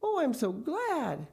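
slow, high, delighted sigh of words; high-pitched happy gushing; overjoyed relief. A narrator's reading. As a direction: draw out the gladness, pitch up. Missus Gregg relieved and overjoyed.

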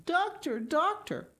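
high, pleading call after him; calling out, tearful. A narrator's reading. Heidi calling after the departing doctor, high tearful cry. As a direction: call him back, voice breaking. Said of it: "calling out in broken tones".

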